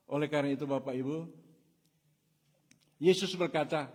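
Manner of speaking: loud, high, rushing preacher call; full-force sermon delivery; fiery preaching. Acted. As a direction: drive it home to the congregation.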